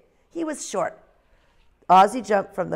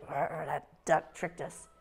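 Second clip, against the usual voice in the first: slow, softer grumble; grumbling; 4 semitones lower, 11 dB quieter, slower than usual. Acted. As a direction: mutter the complaint under your breath. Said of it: "They went away grumbling".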